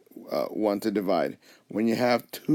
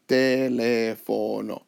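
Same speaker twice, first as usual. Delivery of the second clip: "teléfono" drawn out slowly, each syllable even; counted-out syllables; much slower than usual. Shown as a demonstration. Teacher counting out the word, slow, even syllables in "teléfono". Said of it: "if you count it out".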